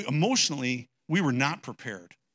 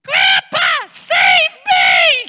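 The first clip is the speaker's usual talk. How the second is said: put-on very high, very loud little girl's scream; child's desperate cry; 20 dB louder, much slower than usual. Granddaughter begging grandpa to save her, high scream. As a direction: shriek like a small girl dragged away. Granddaughter imagined crying for rescue.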